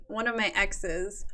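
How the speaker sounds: ordinary delivery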